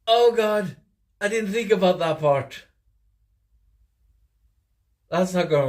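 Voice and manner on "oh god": usual speaking voice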